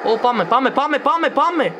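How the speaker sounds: high, loud hype chant; excited cheering. Real, not acted. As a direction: urge yourself on, thrilled. Excited while escaping in game.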